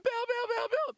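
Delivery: squeaky excited pleading fan; high-pitched kid. Retold or imitated. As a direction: beg like a starstruck kid. Kid calling as he leaves.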